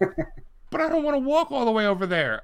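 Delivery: high, whining mock customer; whiny woman. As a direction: whine it like a lazy stranger.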